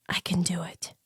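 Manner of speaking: soft whisper into his ear. A narrator's reading. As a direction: breathe it close to his ear. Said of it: "she whispered close to his ear".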